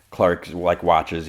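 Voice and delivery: ordinary delivery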